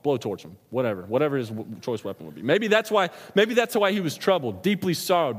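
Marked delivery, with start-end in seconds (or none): 2.4-5.4 louder, higher preaching surge; rising fervor. Real, not acted. Preacher to congregation, heated rising voice. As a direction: let the anger at Satan build.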